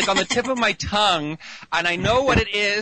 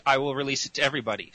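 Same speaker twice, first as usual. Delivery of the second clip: normal talking voice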